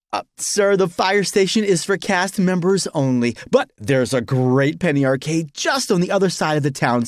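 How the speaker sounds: high-pitched, relentlessly cheery staffer; chirpy customer-service voice; too-happy park employee. Acted. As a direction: redirect a guest with pep. Overly happy employee redirects him.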